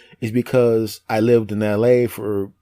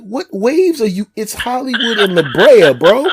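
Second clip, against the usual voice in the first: high, loud mock disbelief; incredulous; 10 semitones higher, 7 dB louder than usual. Friend challenging a surfer in Hollywood, loudly incredulous. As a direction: blurt it out, utterly baffled by a friend.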